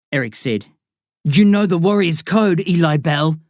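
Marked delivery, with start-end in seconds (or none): 1.2-3.4 formally. Acted. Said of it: "Darren said formally".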